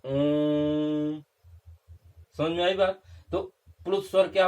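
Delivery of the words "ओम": ओम। drawn out long on one steady held note; prolonged vowel. Shown as a demonstration. Teacher shows students the extra-long pluta vowel: ओम। held long and steady. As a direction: stretch ओम। like a chanted Om, holding the vowel well past normal length. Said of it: "लंबा समय लगता है".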